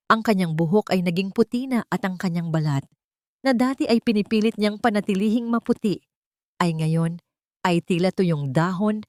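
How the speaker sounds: usual speaking voice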